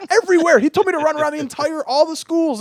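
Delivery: high-pitched, loud mock protest; retold exasperated outburst. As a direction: exaggerate the outrage, voice pushed right up. Retold exasperated complaint about running.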